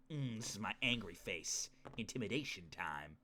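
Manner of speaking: low, quiet mock menace; imitating a little kid's intimidation; childish tough act. Streamer imitating young self at the mirror, mock menace. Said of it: "I'd go look in the mirror and be like".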